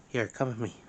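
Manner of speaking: ordinary talk, nothing marked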